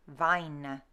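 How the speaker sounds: "vine" with a tiny schwa at the end, vine-uh; long I in "vine", then a faint uh; Middle English pronunciation. Shown as a demonstration. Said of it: "little tiny schwa at the end".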